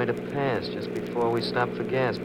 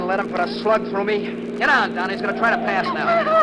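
raised, high, tense orders; urgent shouting; 10 semitones higher, 5 dB louder than usual. Acted. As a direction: shout over the chase, urgently. Driver shouts during chase.

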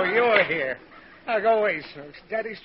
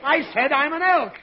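higher, insistent raised voice; exasperated repeat; 7 semitones higher, 3 dB louder than usual. Acted. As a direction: repeat it impatiently to a child who missed it. Repeats after child's huh.

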